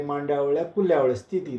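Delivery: usual speaking voice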